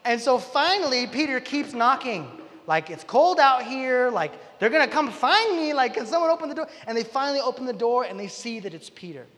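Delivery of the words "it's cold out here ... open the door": high, loud whine of someone locked out; exasperated pleading. Retold or imitated. Peter left knocking in the cold, exasperated pleading. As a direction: act out begging to be let in.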